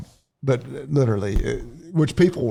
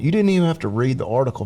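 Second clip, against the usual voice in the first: ordinary talk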